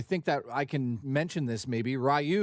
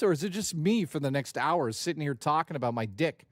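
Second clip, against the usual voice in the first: ordinary delivery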